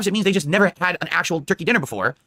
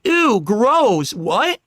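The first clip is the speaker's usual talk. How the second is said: imitating disgusted kids; 3 semitones higher, 3 dB louder, much slower than usual. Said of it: "the kids are saying".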